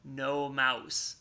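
plain reading voice

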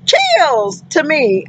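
usual speaking voice

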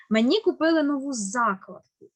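ordinary talk

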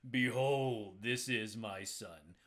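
big, booming voice of God, drawn out; loud opening, slow and falling in pitch. Acted. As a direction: boom it out slowly from the heavens. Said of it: "like a booming voice".

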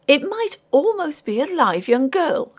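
high-pitched mother's voice; scandalised lady. A narrator's reading. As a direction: observe it critically, up high. Mother scrutinises the figure.

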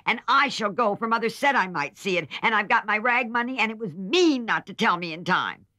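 defiant little sister demanding her way; Amy insisting. A narrator's reading.

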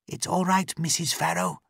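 high female character voice, soothing; reassuring policewoman. Acted. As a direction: reassure the captive through the door. Layla reassures captive.

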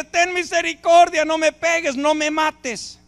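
frightened plea not to be hit; begging for mercy. Acted. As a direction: plead with the one about to strike you. Someone begs not to be beaten.

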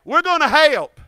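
help stretched into two syllables, hey-elp, loud. Shown as a demonstration. Leading the crowd, help drawn into two syllables. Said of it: "the word help has two syllables".

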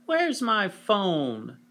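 high, slow whine; whining child. Acted. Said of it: "whined Henry".

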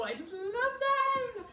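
high, slow, gushing squeal; excited squeal. Real, not acted. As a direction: squeal it out with delight. Gushing about favourite dungarees.

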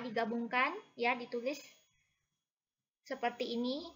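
ordinary delivery

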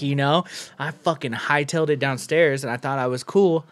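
ordinary delivery

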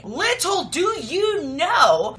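dramatic storytelling. Acted. Teasing twist in game plot.

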